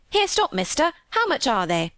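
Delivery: high-pitched start, Yorkshire mother hailing; sudden call. Acted. Maid imitating mother stopping a pedlar, sudden. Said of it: "she calls out quite sudden".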